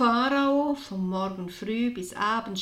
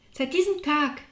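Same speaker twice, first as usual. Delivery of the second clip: proud; 6 semitones higher, 4 dB louder than usual. Acted. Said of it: "erklärte Jakobus stolz".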